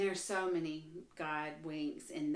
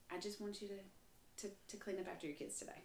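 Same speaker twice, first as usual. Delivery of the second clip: hushed, gentle nudge, much softer than usual; soft prompting; 13 dB quieter than usual. Acted. God nudging a mom to clean up, soft prompting. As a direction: quietly slip in a small request. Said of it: "things that he's whispering to me".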